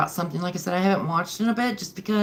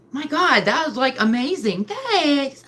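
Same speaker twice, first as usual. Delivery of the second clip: delighted surprise; 7 semitones higher, 3 dB louder than usual. Real, not acted. Gasp at a viewer's gift.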